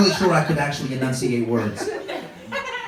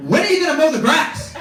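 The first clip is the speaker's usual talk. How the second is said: wife voice; 11 semitones higher, 5 dB louder than usual. Retold or imitated. Wife repeating.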